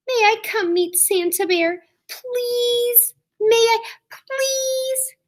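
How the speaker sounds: high pleading little-cub voice, stretched out slowly; begging cub. Acted. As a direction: beg Mama like a small child.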